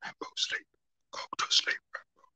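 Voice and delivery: soft unvoiced whisper; hushed voice of her own body; whispered plea. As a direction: whisper like your tired body begging. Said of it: "My body is screaming out".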